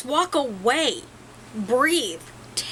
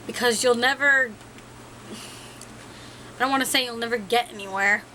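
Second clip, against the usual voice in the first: ordinary talk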